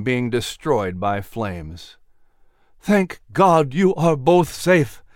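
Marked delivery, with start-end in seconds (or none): 2.8-5.0 slow, raised, shaken relief; emotional, higher and drawn out; trembling voice. A narrator's reading. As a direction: let relief tremble through each slow word. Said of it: "said with a trembling voice".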